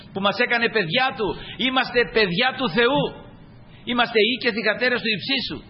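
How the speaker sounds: ringing declaration to the congregation; preaching. Real, not acted. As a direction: proclaim it with lifted voice.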